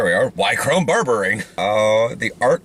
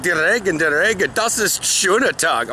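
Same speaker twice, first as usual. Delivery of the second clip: higher-pitched mock-sarcastic German quote; 6 semitones higher than usual. Retelling an overheard remark about rain, sarcastic German. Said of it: "first time I heard sarcastic German".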